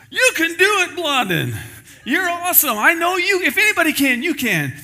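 high, loud, gushing fan cheer; cheering crowd. Retold or imitated. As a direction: egg him on like an adoring crowd.

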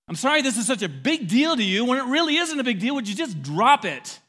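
high, loud sarcastic outburst; hammed-up irritated non-apology; exasperated fake apology. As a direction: throw the apology back angrily. Acting out a false apology.

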